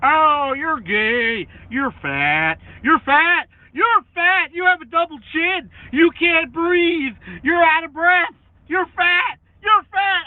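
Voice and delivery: high loud whiny taunting; mocking trolls. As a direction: chant the insults in a whiny high mock voice. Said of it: "mocking insulting trolls".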